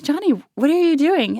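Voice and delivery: high, loud call of surprise; calling to brother. Retold or imitated. Calling to little brother at the sink, loud and surprised. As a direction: call out across the kitchen, startled. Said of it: "I look over and I'm like".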